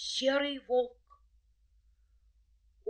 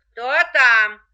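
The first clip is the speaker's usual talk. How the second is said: gruff wolf; 4 semitones higher, 14 dB louder than usual. A narrator's reading. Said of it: "спрашивает волк".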